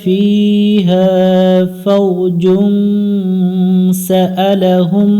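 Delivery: slow, drawn-out melodic tilawat; Quran recitation. Shown as a demonstration. Teacher reciting the verse to students, slow melodic recitation. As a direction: chant each word long and high.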